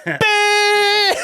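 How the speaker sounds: very high, sustained horn blast; long piercing "beep"; air horn imitation.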